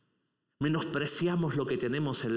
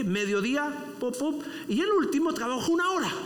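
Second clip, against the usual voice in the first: fervent preaching; 8 semitones higher than usual. Acted. Preacher retelling the vineyard parable, preaching at full force. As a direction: drive the parable home with fire.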